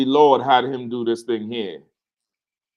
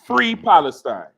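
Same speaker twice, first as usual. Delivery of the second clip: drawn-out, louder mock yell; retold yelling; 5 semitones higher, 6 dB louder, slower than usual. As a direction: imitate a protester's slow shout. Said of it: "and started yelling".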